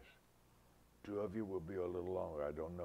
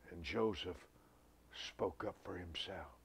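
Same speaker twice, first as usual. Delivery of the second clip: usual speaking voice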